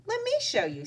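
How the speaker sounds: put-on high, bright friendly girl voice; cheerful helpful girl; with a smile. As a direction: offer help with a smile. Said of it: "said Kelly with a smile".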